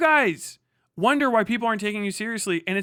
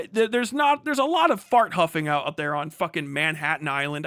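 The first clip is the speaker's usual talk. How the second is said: ordinary delivery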